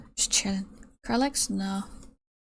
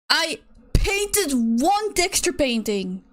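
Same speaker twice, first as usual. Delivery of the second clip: indignant; 5 semitones higher, slower than usual. Real, not acted. Indignant reply to a critic.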